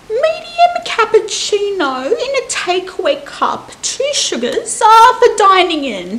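high, loud, hammed-up posh old lady; theatrical posh customer ordering coffee, high and loud. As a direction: play a grand fussy customer calling everyone dearie. Fussy customer in coffee skit.

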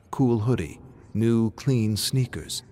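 ordinary delivery

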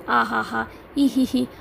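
spoken-out mock laughter. A narrator's reading. Orator ridicules his gullible audience, mocking laugh. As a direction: mimic silly laughs in a restrained reading. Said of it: "తన వెక్కిరింపు".

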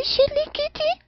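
tiny kitten voice, very high; high kitty voice. Acted. Streamer announcing her new Twitter account, in a high kitty voice. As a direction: jump way up into a small-cat voice. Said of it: "cabe muy bien esa voz alta".

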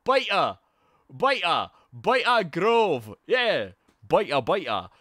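loud, chanting Geordie catchphrase; hammed-up Geordie accent. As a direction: belt it out like a Geordie telly fan. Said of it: "Wasn't that Geordie?".

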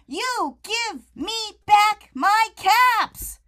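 high, loud and slow, demanding; angry shout. Acted. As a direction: drag out each word like a furious order. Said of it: "shouting a".

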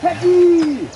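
calling out. Real, not acted. Calling for the dog.

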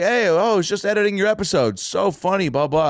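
imitating Larry praising the episode; Larry David impression. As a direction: gush casually, then wave it off.

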